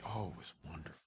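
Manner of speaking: very soft, low, hushed delivery; careful placating answer. Acted. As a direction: softly savor the answer for the wife. Eager-to-please careful answer to wife.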